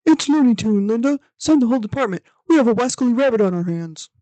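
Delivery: loud, panicked radio call; police radio. Pretend. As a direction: shout it over the police radio. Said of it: "the dispatch officer trying to radio this in".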